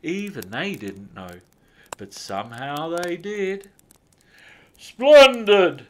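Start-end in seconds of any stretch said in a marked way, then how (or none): 4.8-5.8 loud, high jolly cry; booming Santa. A narrator's reading. Santa praising the elves, booming. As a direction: boom it with delight. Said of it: "boomed Santa".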